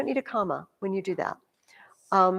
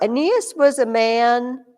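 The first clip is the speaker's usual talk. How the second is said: slow, louder reading of the cut sentence; slow demo reading; 2 semitones higher, 6 dB louder, slower than usual. Shown as a demonstration. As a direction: read the cut sentence slowly. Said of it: "If I take that out".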